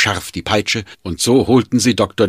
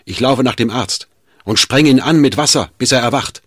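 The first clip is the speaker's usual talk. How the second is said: loud, raised, hurried-sounding command; urgent barked orders; 6 semitones higher, 5 dB louder than usual. A narrator's reading. Schmidt to the servant before running for the doctor, barked orders. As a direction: fire instructions at the servant. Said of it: "herrschte Schmidt den Diener an".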